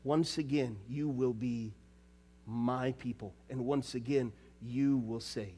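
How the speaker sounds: very soft, tender closing words; barely above a breath, full of mercy; hushed promise. Acted. Preacher softly speaking God's promise of mercy.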